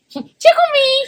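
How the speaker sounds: high, squeaky giggly voice. Acted. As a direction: squeak it like a toy. High impression voice.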